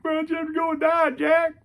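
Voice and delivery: hammed-up sobbing plea to a dead hero; mock crying. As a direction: wail it like a heartbroken fan. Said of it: "I was like".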